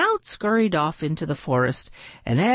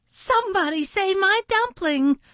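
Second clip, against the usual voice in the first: very high, frantic mother duck; panicked mother calling. A narrator's reading. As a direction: cry out for help in panic. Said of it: "she called out".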